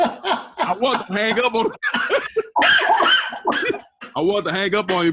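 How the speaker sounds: words broken by loud laughter; can't stop cracking up mid-sentence; laughing. Real, not acted. Breaks up laughing on call.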